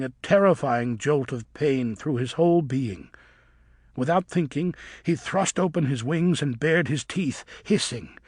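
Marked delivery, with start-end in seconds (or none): none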